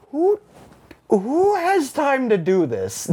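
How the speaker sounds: high, slow, exasperated question; exasperated disbelief. Retold or imitated. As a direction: drag it out in disbelief. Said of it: "And I'm like".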